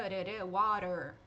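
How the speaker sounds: rã, rã, water said slowly, the middle sound flicked like a quick D; tapped T demo. Shown as a demonstration. Teacher slowly drilling the American flap for students. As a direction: drag out rã, rã, water to show the flap. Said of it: "Esse som de rã".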